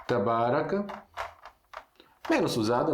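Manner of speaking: ordinary talk, nothing marked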